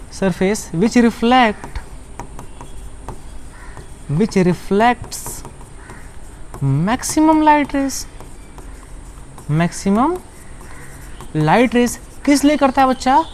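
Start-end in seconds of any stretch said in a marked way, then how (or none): 0.1-10.2 slow dictation. Shown as a demonstration. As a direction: dictate so they can write. (धीरे-धीरे बोलकर लिखवाना)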